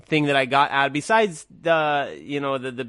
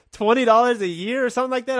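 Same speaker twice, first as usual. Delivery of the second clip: higher, disbelieving echo of the price after a laugh; amused disbelief; 6 semitones higher, faster than usual. Retold or imitated. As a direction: repeat it like you can't believe it's so cheap. Amazed the school fee is so low.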